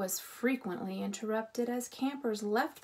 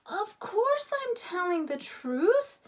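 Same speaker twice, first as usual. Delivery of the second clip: much higher, louder offended retort; indignant; 10 semitones higher, 5 dB louder than usual. A narrator's reading. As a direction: bristle at being doubted. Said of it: "Ralph was indignant".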